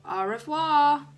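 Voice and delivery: put-on loud, slow, deliberately un-French accent. A narrator's reading. Dennis calling back to teacher in a deliberately un-French accent. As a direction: drag out the French with the least French accent possible. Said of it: "in the most un-French accent he could manage".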